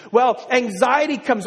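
usual speaking voice

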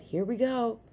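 sighed, mild dread; resigned sigh. A narrator's reading. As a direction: brace for trouble with a sigh. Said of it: "Som Kit sighed".

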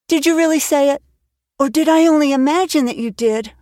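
put-on a girl barely daring to hope; breathless girl. Anne begging Marilla to let her stay, breathless and high. Anne's voice continues.

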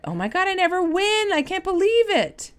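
high, gushing mock astonishment; giveaway winner's disbelief. A giveaway winner reacting to the prize, high mock amazement. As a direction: play up the shocked winner. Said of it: "they will say that".

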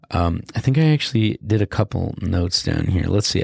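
normal talking voice

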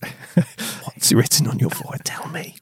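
soft breathy whispered sweet talk; whispering. Pretend. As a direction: whisper it like a secret lover. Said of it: "Quiet love talking".

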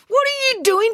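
very high, alarmed demand; high-pitched indignant question. A narrator's reading. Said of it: "demanded April".

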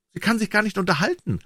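high-voiced little swallow; complaining swallow. A narrator's reading. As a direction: grumble about a sweetheart, pitched high. Swallow complains about his beloved.

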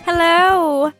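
usual speaking voice

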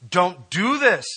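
loud, high desperate plea; imagined Joseph begging his brothers; pleading. Retold or imitated. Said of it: "Joseph is there pleading with them".

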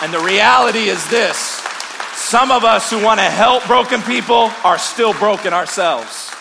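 loud, high, ringing preacher's call; full-force preaching. Acted. Pastor preaching to his church, loud and high. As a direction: preach it out to the whole room.

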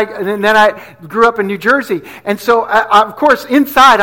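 usual speaking voice

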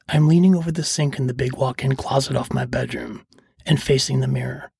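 ordinary delivery

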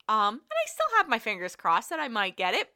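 normal talking voice

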